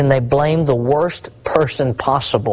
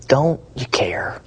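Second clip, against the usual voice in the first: whispered plea; 4 dB quieter, slower than usual. Acted. Preacher voicing apostles' plea.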